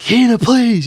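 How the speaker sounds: pleading. Retold or imitated. Adeline pleading with Hina to let her take over, high urgent plea. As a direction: beg her to come back. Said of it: "Adeline's like".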